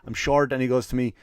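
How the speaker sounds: ordinary delivery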